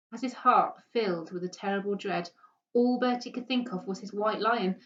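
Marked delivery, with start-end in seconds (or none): none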